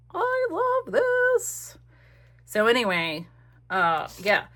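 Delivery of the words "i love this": high, slow, drawn-out gushing; delighted singsong. Real, not acted. Crafter gushing over her page in a high, slow singsong. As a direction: sing the words out in delight.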